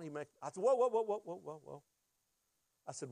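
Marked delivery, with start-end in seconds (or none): 0.6-1.8 rapid string cutting someone off, fading away; interrupting. Retold or imitated. Husband stops wife reciting the psalm, interrupting.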